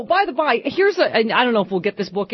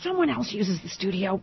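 whispering on the phone; 8 dB quieter than usual. Real, not acted. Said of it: "Jim, I have to whisper".

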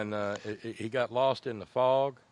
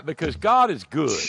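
lightly imitated comic storyteller, louder; 3 semitones higher, 7 dB louder than usual. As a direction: boom it out like a stage routine. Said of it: "that old [name] routine".

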